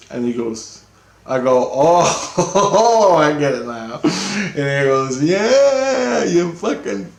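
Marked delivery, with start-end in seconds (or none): 1.7-4.0 loud, high "oh" breaking into a laugh; hammed-up penny-drop moment, laughing; dawning realization. Acts sudden realization to friend. 5.2-7.1 high, loud, drawn-out mock "yeah"; exasperated confirmation. Friend's exasperated yes, acted out.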